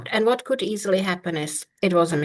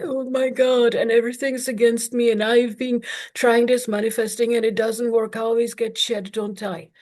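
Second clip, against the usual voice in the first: mock freak-out; 3 semitones higher than usual. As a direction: whine like everything is against you. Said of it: "freak out and say".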